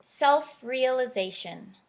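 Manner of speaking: gentle storybook read-aloud; reading to children. Acted. Author reading her picture book to listeners, gentle storybook voice. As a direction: read it like bedtime story time.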